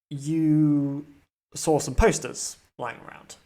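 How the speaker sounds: dramatic GM narration, setting the scene for the players; game master scene setting. Acted. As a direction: draw the table into the story like a storyteller. GM sets the opening scene.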